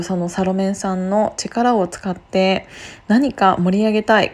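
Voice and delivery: usual speaking voice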